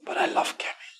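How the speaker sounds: whispered, pleading; whispered confession. Acted. Dennis confessing to his mother, whispered. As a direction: breathe it out as a secret.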